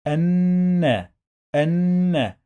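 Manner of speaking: الن slowly as "annnn-na", high and loud; doubled noon. Shown as a demonstration. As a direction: hold the n in الن, then release "na". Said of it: "We pronounce نون two times".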